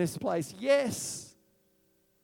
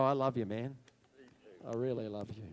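mock loving; 10 semitones lower, 8 dB quieter than usual. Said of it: "You go".

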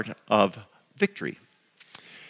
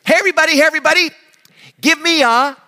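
high, loud cheerleader call; 7 dB louder, faster than usual. Retold or imitated. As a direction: shout it like a peppy cheerleader. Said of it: "a young gal stands on a chair, and she says".

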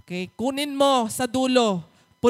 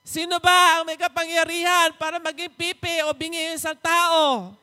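booming, exasperated divine rebuke; God rebuking loudly; 7 semitones higher, 5 dB louder than usual. Acted. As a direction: thunder at Moses like an impatient God. Preacher voices God scolding Moses. (malakas at naiinis na tinig ng Panginoon)

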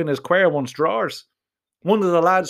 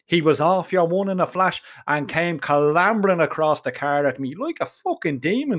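ordinary delivery

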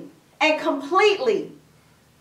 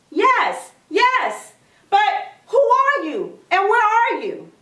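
higher-pitched acted questioning; urgent calling back; 5 semitones higher than usual. Jack asking the voice who it is, urgent calling. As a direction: call up high to someone unseen. Stranded man questions the unseen voice.

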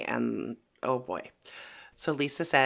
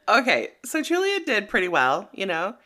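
normal talking voice

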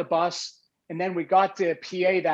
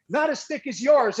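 high-pitched mock angry retort; retold yelled comeback; 8 semitones higher than usual. Acting out own comeback to the coach, mock angry. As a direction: throw the comeback up high, as if arguing. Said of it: "I'm yelling at him".

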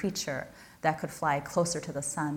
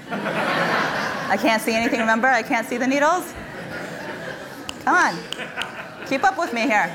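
laughing, higher and louder; giggling as she teases the crowd; laughing at own joke; 5 semitones higher, 5 dB louder than usual. Real, not acted. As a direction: chuckle through the punchline. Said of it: "That's supposed to be a joke".

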